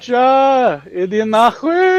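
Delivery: loud high mock Russian greeting; Russian accent. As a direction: ham up a movie Russian villain. Mock Russian bad-guy voice.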